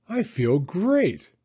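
ordinary delivery

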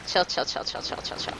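soft, whispered self-calming; panicking. Real, not acted. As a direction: whisper it repeatedly to steady your nerves. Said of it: "I'm panicking".

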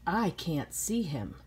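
ordinary delivery, nothing marked

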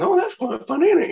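very high squeaky mock voice; imitating eighties folk. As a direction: send up how everyone talked back then. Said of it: "they all sounded like that".

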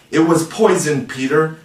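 ordinary delivery

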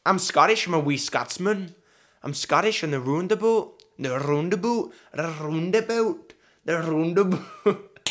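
put-on hammy Scottish accent. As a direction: attempt a broad Scots accent. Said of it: "Right, Scottish".

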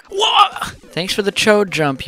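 sudden high yelp; startled yelp. Real, not acted. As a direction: yelp in surprise. Sudden gaming outburst.

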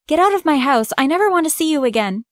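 put-on angry girl yelling get out. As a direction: throw her out of the house, heated. Furious ex-friend throws her out.

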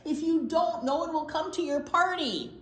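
insistent cry. A narrator's reading. Bear warns Skunk about the party, insistent cry. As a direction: cry out a pressing warning. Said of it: "cried Bear".

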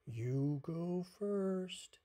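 very slow, loud drawn-out words; ponderous storybook turtle voice. A narrator's reading. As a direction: stretch every word like an unhurried turtle.

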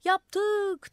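high, loud "Yaptık!" thrown upward; crowd shouting. A narrator's reading. Crowd yelling up to the madman on the roof. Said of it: "diye bağırdılar".

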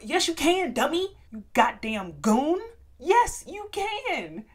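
loud, high-pitched telling-off; exasperated scolding. Acted. Speaker rebuking people who complain about consent, exasperated. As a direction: cut in and shout the complainers down.

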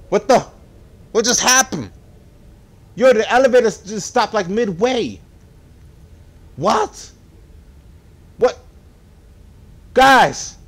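high, loud incredulous outcry; appealing loudly to the viewers; shocked disbelief. Real, not acted. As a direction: exclaim in real disbelief. Elevator stops midway.

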